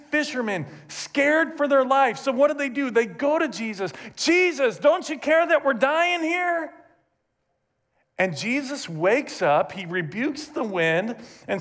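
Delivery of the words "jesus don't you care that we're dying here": panicked plea. Acted. Disciples panicking in storm.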